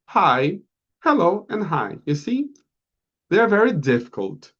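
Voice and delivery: usual speaking voice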